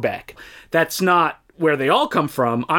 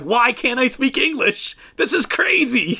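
put-on childish complaint; 8 semitones higher than usual. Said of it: "So I'm like".

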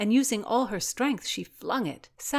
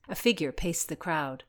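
ordinary delivery

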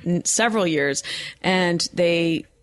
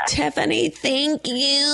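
high, drawn-out thank-you; gushing thanks; 7 semitones higher, slower than usual. Real, not acted. Host thanking friend for calling in, gushing. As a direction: gush it, pitched way up.

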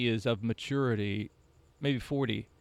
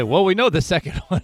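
loud, high, knowing voice; smug old ballplayer; 7 semitones higher, 11 dB louder than usual. Retold or imitated.

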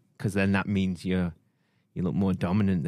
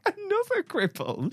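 high, mock-appalled old lady; mock shocked old lady. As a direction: gasp it like a scandalised granny. Mock shocked repeat of clip.

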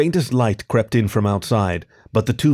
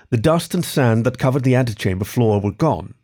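ordinary delivery

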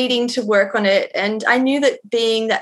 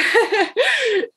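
short high laugh, falling away; real laugh at the size of her own story; high burst of laughter; 11 semitones higher than usual. Real, not acted. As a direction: laugh it off, a little sheepish. Laughing after telling big story.

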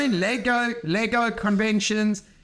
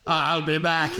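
low monotone throat-cancer rasp; robotic voice-box drone; 4 semitones lower than usual. Acted. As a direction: imitate a man talking after throat cancer. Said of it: "throat cancer".

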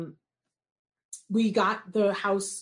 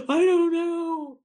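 gasp, then a high, loud, drawn-out wail; hammed-up helpless cry, stretched slow; acted wail; 9 semitones higher, 6 dB louder, slower than usual. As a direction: wail it in mock despair. Acts out her overwhelmed wailing reply.

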